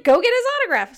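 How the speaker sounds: high, loud eager push; excited urging. Retold or imitated. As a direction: urge her on, thrilled. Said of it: "I was like".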